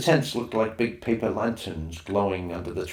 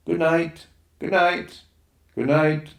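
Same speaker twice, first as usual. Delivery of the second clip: calling good night; 8 semitones higher, 4 dB louder, slower than usual. Acted. Campers calling between tents.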